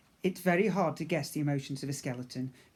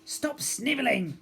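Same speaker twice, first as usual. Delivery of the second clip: scolding crew member; 9 semitones higher, 4 dB louder than usual. Acted.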